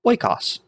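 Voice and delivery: "oikos" as OI-kos, pitched high then falling; Koine Greek pronunciation. Shown as a demonstration. Teacher shows how ancient Greeks said it, "oikos" as OI-kos. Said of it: "the Koine Greeks would have pronounced it".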